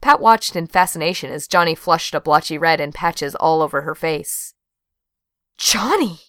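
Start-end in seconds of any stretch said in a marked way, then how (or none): 5.6-6.2 high-pitched gasp of the name; hammed-up shocked exclamation; mock gasp. A narrator's reading. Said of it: "she gasped".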